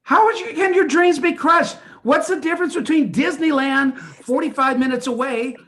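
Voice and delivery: high, loud exasperated yelling. Acted. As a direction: shout it at your wife in disbelief. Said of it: "You're yelling at me?".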